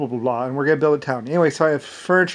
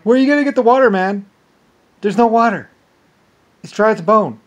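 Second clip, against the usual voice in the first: raised, incredulous heckling; scornful mock protest; mocking challenge; 7 semitones higher, 5 dB louder than usual. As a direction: imitate doubters jeering, pitched up and louder. Voices people laughing at him.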